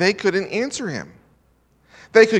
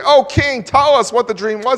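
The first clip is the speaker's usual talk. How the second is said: raised, eager courtiers' plea; higher and louder, begging the king; pleading advisors; 6 semitones higher, 5 dB louder than usual. Retold or imitated. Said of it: "They're ready to give the interpretation and say".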